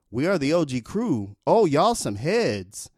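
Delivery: lightly voiced rapper talking to the crowd; rapper on stage. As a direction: address the crowd like a rapper pointing at fans.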